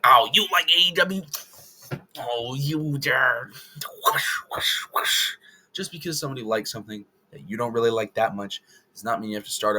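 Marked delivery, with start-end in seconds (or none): 0.0-5.3 mock hostile fan. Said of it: "You don't have to be like".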